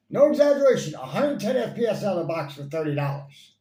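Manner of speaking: loud, high-pitched burst of amazement; emphatic exclamation. Real, not acted. Reviewer hyping the blaster's power for the price, loud and emphatic. Stressing an amazing spec and price.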